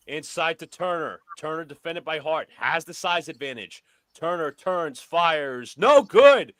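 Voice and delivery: brisk sportscaster calling the action; play-by-play commentary. Pretend. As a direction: call it like a courtside announcer. Plays announcer over the game.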